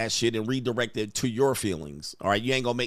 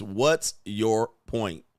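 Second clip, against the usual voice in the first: slow dismissive; 2 semitones lower, much slower than usual. Retold or imitated. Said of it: "her response to you questioning her is".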